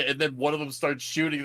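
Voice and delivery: usual speaking voice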